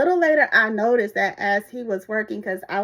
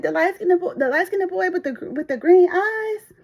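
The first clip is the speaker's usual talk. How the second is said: high, dreamy, slowing at the end; swooning coworker; 8 semitones higher, 7 dB louder than usual. Retold or imitated. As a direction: linger on green eyes like a crush. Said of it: "She said".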